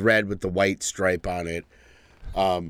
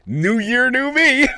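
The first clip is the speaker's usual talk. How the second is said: high, rising mock-hype, louder; mock slogan; 6 dB louder, slower than usual. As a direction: ham up the cliché with a laugh. Mocking new year slogan.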